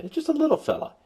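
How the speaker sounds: very high squeaky little-fella voice; tiny cartoon voice. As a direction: voice the tiny iota as a cute little creature. Iota personified as little guy.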